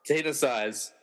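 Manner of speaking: usual speaking voice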